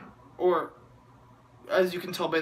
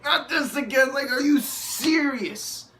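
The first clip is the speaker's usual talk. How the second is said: higher-pitched fed-up groan, slowing into a flat verdict; exasperated disbelief; 7 semitones higher than usual. Retold or imitated. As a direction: roll your eyes at the screen. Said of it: "I'm just like".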